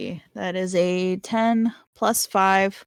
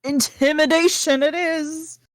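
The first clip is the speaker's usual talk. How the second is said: gleeful decision; 9 semitones higher, 3 dB louder than usual. Real, not acted. Player gleefully picks intimidation.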